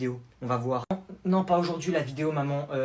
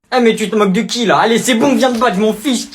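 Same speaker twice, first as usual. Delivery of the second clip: louder, higher taunt; daring the cousin to fight; taunting challenge; 7 semitones higher, 8 dB louder than usual. Acted. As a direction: bait him into fighting.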